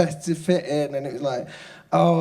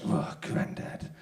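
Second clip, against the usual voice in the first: mocking aside; 7 semitones lower, 8 dB quieter, slower than usual. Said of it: "He went".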